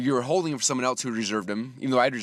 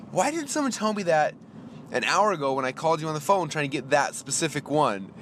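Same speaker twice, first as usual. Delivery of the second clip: retold irate customer, pitched up; exasperated complaint; 6 semitones higher than usual. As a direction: demand an explanation in disbelief. Retold complaint to rental clerk.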